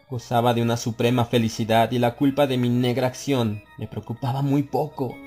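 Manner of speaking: plain reading voice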